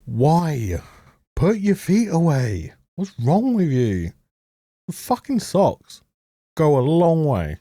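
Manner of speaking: high, disgusted pleading at the screen; grossed out and fed up; exasperated. Real, not acted. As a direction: put your feet away, appalled. Exasperated at character's bare feet.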